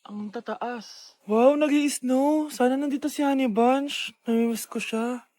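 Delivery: normal talking voice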